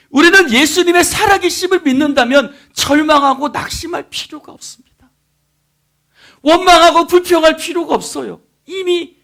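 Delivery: high, loud sermon exhortation; impassioned preaching. Real, not acted. Preacher telling believers not to complain, exhorting loudly. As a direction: press the point on them forcefully.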